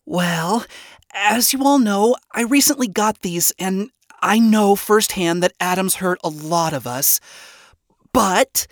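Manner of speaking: high, chipper voice falling short of real excitement; forced enthusiasm. A narrator's reading. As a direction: try hard to sound enthusiastic. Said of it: "tried her best to sound enthusiastic".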